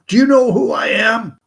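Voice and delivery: high, loud, strange devil voice; menacing devil. Acted. Satan in the church demands the old man know him, menacing. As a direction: loom over him as Satan.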